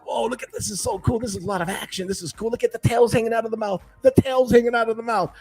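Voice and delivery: put-on high-pitched, awestruck kid excitement; retold excitement. Kids watching the Oscar eat fish, retold excitement. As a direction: gush like a spectator at a fight.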